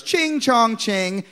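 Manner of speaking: very slow sing-song mock noises. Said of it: "just racist noises".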